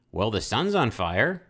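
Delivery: hammed-up Southern accent for an astronaut. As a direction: stretch it out in a put-on Southern accent. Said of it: "this southern accent".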